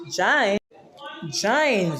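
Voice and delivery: "jine" rhyming with "line"; Patois pronunciation. Shown as a demonstration. Demonstrating the Patois word to viewers, "jine" with long I. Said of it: "they say jine".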